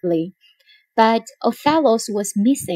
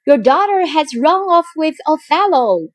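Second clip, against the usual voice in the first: shouted news; 7 semitones higher, 4 dB louder than usual. Acted. Iago shouting news up to father.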